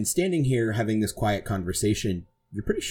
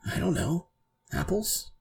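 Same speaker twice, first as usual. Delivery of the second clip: put-on higher, soft evasive kid; a hint of a guarded youngster; lightly voiced child; 8 semitones higher, 4 dB quieter than usual. GM voices wary child in disguise.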